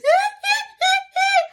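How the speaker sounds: very high, loud staccato mock wail; high-pitched cry imitation. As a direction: squeal out an imitated boo-hoo. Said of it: "one of them high-pitched".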